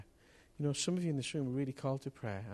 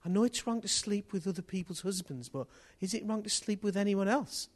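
lightly voiced woman, higher, puzzled rising question; a hint of a sincere puzzled woman; retold woman asking, a touch higher; woman's question; 9 semitones higher, 4 dB louder than usual. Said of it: "she said".

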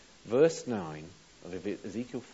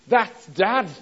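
put-on excited kid shout; 10 semitones higher, 14 dB louder than usual. Kids recognising the ogre.

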